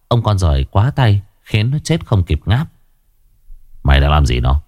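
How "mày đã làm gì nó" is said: low, cold and level question. A narrator's reading. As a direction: ask it icily, without any warmth. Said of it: "Tiến Lò Đúc lạnh lùng".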